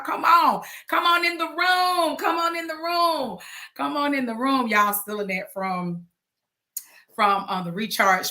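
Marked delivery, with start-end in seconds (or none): 0.0-4.7 high, sustained sing-song call; rallying slogan call. Acted. Host calling the crew into the room, high sing-song chant.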